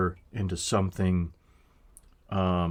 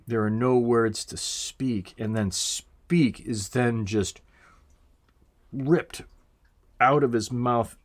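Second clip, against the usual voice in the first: usual speaking voice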